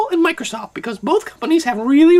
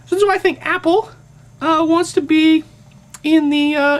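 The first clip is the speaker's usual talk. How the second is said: normal talking voice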